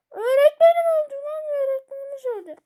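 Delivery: high, loud hammed-up crying; fake-wail over the dead teacher; mock wailing. Gamer mourning the game teacher in mock sobs.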